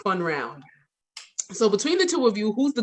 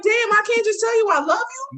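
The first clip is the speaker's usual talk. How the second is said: high, mock-offended protest; indignant; 12 semitones higher than usual. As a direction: act hurt in jest. Retold own indignant reply.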